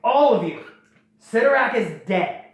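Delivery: rally the army, hammed-up captain; mock battlefield announcement, loud and high; commanding call to the horde. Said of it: "Vakama goes".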